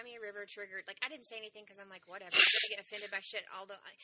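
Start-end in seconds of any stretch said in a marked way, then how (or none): 2.1-3.8 fast, loud, brushing it all off; dismissive inner voice. Retold or imitated. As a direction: blurt it out fast and careless, like it doesn't matter. Inner voice, shrugging offence off.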